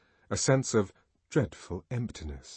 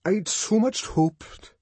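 much higher, very slow faltering plea; stammering; 12 semitones higher, 5 dB louder, much slower than usual. A narrator's reading. Bernard pleading to the dignitary, slow high stammer. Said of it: "he stammeringly repeated".

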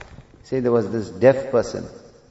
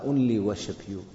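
ordinary delivery

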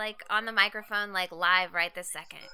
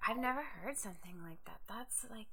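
whispering; 11 dB quieter than usual. Real, not acted. Awed aside about the odd sound.

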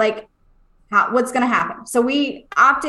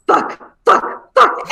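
punchy, rising mock outburst; imitated pained cursing; 7 semitones higher, 4 dB louder, much slower than usual. Mom acting out her son cursing as the stick is pulled, pained outburst. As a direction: blurt each word like a hurting kid. Son curses through pain, retold.

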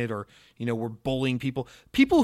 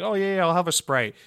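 imitating a casual customer, higher and louder; customer ordering; 5 semitones higher, 4 dB louder than usual. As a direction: toss off the order like a diner. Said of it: "and he's like".

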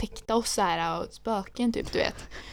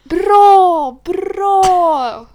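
high loud cheer; hammed-up cheer; mock cheering; 10 semitones higher, 12 dB louder than usual. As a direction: cheer her on loudly. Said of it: "jag bara".

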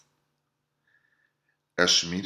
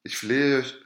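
higher, louder begging cry; desperate plea; 7 semitones higher, 5 dB louder than usual. A narrator's reading. Student freezing at the door, higher louder plea. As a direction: beg with full force. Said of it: "rief der Student".

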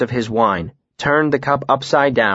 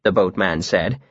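ordinary delivery

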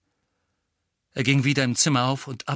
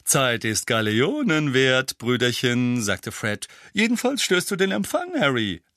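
lightly voiced cheeky twin; 3 semitones higher, 4 dB louder than usual. A narrator's reading.

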